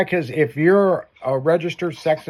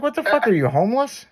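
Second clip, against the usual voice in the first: incredulous; 9 semitones higher than usual. Retold or imitated. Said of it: "I'm like".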